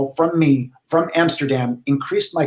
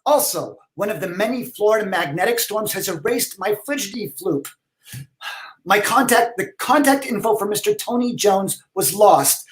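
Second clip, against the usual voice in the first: character letter voice; 9 semitones higher than usual. Acted. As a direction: read the email as the fussy pen-pal character. Reads his email in character.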